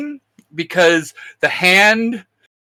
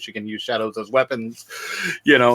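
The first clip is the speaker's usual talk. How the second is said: ordinary talk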